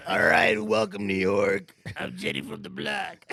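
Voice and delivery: loud, drawn-out stage call to the crowd; mock J.Lo concert shout. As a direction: hype up New York like a pop star onstage. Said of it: "She's like".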